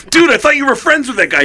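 high-pitched mock disbelief; incredulous. Bandmates to drummer about his tattooist, incredulous. Bandmates' puzzled reply retold.

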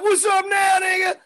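high loud threatening shout; mock menacing shout. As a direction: yell it like an ambush. Big Boy ambush retold again.

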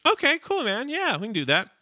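eager agreement. Retold or imitated. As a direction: agree readily, voice jumping up high. Acts his younger self agreeing.

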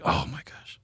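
hushed mock exasperation; exasperated groan. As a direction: sigh it out like you're fed up. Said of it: "it's like".

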